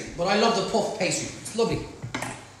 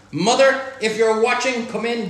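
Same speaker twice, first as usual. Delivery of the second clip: louder call to Mother; 4 semitones higher, 6 dB louder than usual. Real, not acted. Cook calling his mother to dinner, louder. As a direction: shout it out to her.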